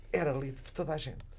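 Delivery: usual speaking voice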